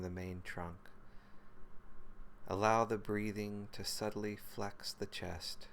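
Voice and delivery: calm, even meditation voice with long pauses; guided meditation. Real, not acted. Teacher leading listeners through body and breath, guided meditation. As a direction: guide them gently into the body, phrase by unhurried phrase.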